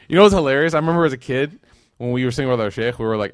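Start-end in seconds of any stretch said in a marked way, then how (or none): 0.1-1.6 bursting with amusement, louder; eager, laughing lead-in to a funny memory; lively excited aside. Real, not acted.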